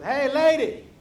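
heckling parrot, big call and deliberate jab. Acted. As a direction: holler it like a rude parrot. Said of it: "the parrot goes".

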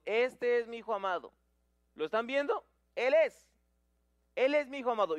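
a father calling out proudly to a gathered crowd; proud loud proclamation. Acted. Father presenting his son to the crowd, proud and loud. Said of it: "les dice a la multitud".

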